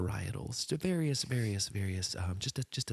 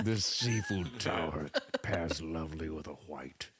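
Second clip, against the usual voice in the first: put-on salty old fella; 4 semitones lower than usual. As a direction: play a weathered old sailor. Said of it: "just a salty old fella".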